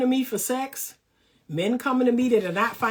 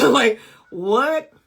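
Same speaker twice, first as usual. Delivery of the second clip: laughing disbelief; 7 dB louder than usual. Real, not acted.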